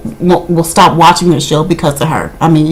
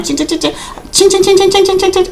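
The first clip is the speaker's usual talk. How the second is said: cash register ching; 11 semitones higher, 3 dB quieter than usual. Pretend. Dollar signs in Shawnee's eyes.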